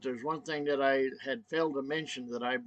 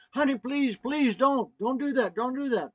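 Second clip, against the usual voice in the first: high, urgent begging; acted-out anxious plea, pitched up; retold pleading; 9 semitones higher, 4 dB louder than usual. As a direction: plead with her as in the moment. Pleading with wife to stop.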